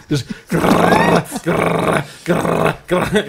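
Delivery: growled gunfire noise; machine gun growl. Retold or imitated. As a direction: rattle out a gun sound. Imitating machine gun fire.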